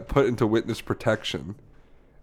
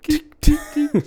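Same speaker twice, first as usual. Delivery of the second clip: high, sharp little ticks; imitated ticking; 5 dB louder, slower than usual. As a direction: mimic a failing stand going tick. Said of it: "I just started hearing the tick".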